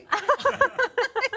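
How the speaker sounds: high, loud delighted laugh; embarrassed laugh recalling the bathroom; high burst of laughter. Real, not acted. Laughing about accosting her.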